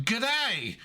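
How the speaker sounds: high, exaggerated Aussie greeting; imitating a cartoonishly Australian assistant; over-the-top Australian accent. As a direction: ham up a full-blown Aussie accent. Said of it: "an Australian accent".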